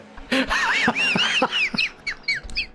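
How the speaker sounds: laughing hard at his own joke; high giggle fit; high squealing laugh. Real, not acted. Laughs at own cat impression.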